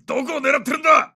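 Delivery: loud, high battlefield yell; angry shout. Acted. Soldier scolds teammate for bad aim, angry loud shout. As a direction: shout down a careless shooter.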